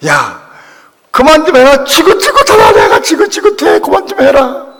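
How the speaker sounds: high, loud outburst of fed-up scolding; imitating mother's exasperated yelling. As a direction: shout as a mother at the end of her patience. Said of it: "통사상을 했어. 그만하라고".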